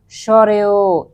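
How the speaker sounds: স্বরেও with a long, slow final vowel; স্বরেও sounded slowly for pupils; vowel demonstration. Shown as a demonstration. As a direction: draw out the end of স্বরেও. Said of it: "এটা উচ্চারণ করতে আমাদের".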